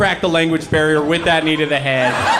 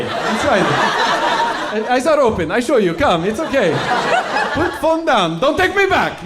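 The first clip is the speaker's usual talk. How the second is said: put-on high, loud, accented pleading; imitated panicking mom; 10 semitones higher, 5 dB louder than usual. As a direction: plead higher and louder as an imitated foreign mom. Immigrant mom pleading with shoppers.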